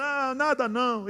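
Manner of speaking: high, whiny imitated sob; mock tearful denial. Crying person insisting it is nothing, mock tearful denial. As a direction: brush it off while pretending to cry. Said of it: "ah, chorando".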